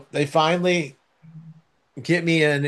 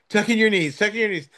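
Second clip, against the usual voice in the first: higher-pitched, insistent imitation of nurses; lightly acted urgency, pitched up; mock urgent orders; 7 semitones higher, faster than usual. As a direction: imitate hurried instructions to a patient.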